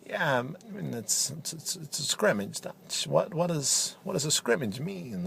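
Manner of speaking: a hint of Christopher Walken, choppy pauses; deadpan Walken-style commentator. Walken impression continued.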